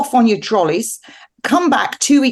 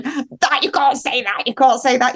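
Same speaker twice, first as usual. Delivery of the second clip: mock protest in a higher voice; imitating objectors; 4 semitones higher than usual. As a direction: lightly voice a scandalised crowd objecting. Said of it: "they're all going".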